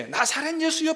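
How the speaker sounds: high called-out 나사렛 예수여 as a contrast; wrong name called. Shown as a demonstration. Preacher shows the name the beggar did not call, high. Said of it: "부르지 않았어요".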